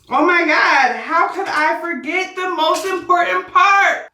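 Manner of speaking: a hyped oh my God to the viewers; excited outburst. Real, not acted. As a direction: burst out, half shouting. Suddenly remembers the last step.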